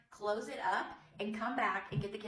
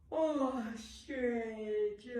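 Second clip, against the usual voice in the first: yawning. Shown as a demonstration. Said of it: "Can you say".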